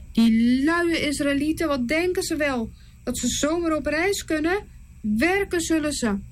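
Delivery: scornful Pharaoh. Acted. Pharaoh ordering the overseers, with mild contempt. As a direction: sneer at the lazy slaves.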